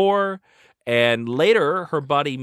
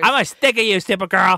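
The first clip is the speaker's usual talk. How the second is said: high, loud put-on character voice; mock rival taunt; 7 semitones higher, 6 dB louder than usual. Rival comic heroine to Supergirl, mock taunting voice. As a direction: taunt in a silly, pitched-up voice.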